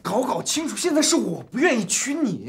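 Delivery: high-pitched indignant retort; quarrelling. Acted. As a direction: argue back hotly. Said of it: "别吵了".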